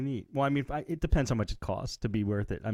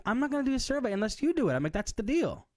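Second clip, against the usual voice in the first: high-pitched, retold ultimatum; mock-indignant insistence in a much higher register; insistent refusal; 10 semitones higher than usual. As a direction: lay down terms, pitch jumping up. Acted standoff with the survey caller.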